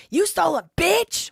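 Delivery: very high, loud accusing shriek; mock shrill yell. Josh yelling at Ben over the Legos, loud high mock shriek. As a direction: screech it at Ben. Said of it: "Why did Josh Johnson say to Ben".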